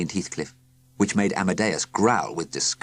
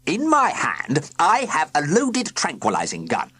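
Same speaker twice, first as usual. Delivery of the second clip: stern villain; 8 semitones higher, 3 dB louder than usual. A narrator's reading. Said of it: "began Sir Quincy sternly".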